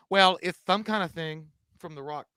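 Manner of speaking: ordinary delivery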